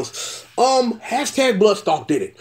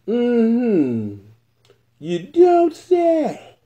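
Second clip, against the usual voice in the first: sarcastic you don't say; 3 semitones higher, much slower than usual. Real, not acted. Sarcastic reaction to plot twist.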